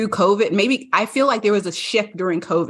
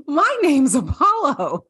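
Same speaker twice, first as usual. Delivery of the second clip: higher, slow, far-off puppy voice; eager dog voice; 6 semitones higher, slower than usual. Pretend. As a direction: play the friendly dog introducing himself. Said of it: "Apollo was like".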